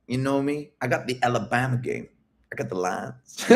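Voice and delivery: a hint of a cocky Alabama flirt; mock Alabama accent. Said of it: "my American accent".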